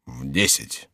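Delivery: low, soft statement; low falling reply; monk answering. Acted. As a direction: drop the answer low and quiet. Monk's flat answer.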